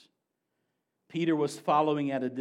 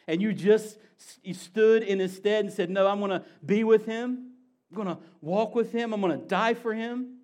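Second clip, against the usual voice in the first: higher, louder impassioned sermon climax; preaching at full force; 5 semitones higher, 5 dB louder than usual. Acted. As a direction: build to a fervent peak. Climactic preaching on Peter's failure.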